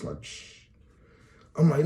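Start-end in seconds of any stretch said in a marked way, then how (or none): 0.2-0.6 soft shushing; guard's quiet shush; hush. Retold or imitated.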